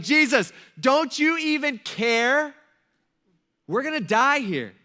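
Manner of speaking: high, loud, slow desperate cry for help; frantic disciples begging Jesus to wake up; panicked plea. Acted. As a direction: plead like you're about to drown.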